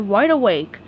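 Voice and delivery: ordinary delivery, nothing marked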